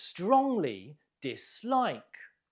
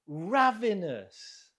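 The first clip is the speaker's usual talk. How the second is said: RAV stressed in Ravenous, drawn out slowly; stress on first syllable; 2 semitones higher, slower than usual. Shown as a demonstration. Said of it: "stress on the first syllable".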